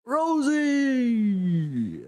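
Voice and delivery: very high, loud drawn-out name; overjoyed shout. Acted. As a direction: cry her name as you grab her. Albert hugs Rosie.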